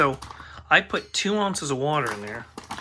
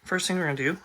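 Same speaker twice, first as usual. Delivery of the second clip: normal talking voice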